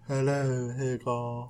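low, very soft, airy greeting; angel voice. Acted. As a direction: put on a hushed, floaty angel. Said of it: "I need to come up with an angel voice".

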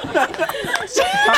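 laughing. Real, not acted. Laughing at the beet joke.

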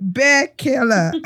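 slow Beer Killer, stress on Beer; place name. Shown as a demonstration. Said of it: "How do you pronounce it?".

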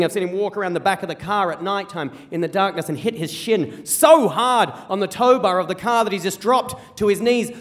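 ordinary delivery

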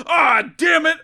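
high, loud cartoon-villain groan of defeat; cartoon villain shout. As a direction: bellow it like a foiled baddie. Villain voiced on being caught.

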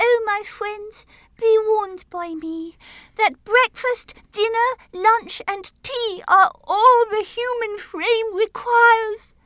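high, loud dying plea; crying out. Acted. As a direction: cry out a final warning, high and loud.

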